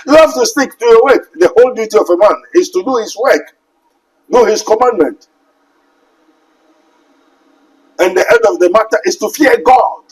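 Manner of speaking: stern pulpit charge; urgent exhortation. Acted. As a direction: command them to stick to the work. Exhortation, higher and louder.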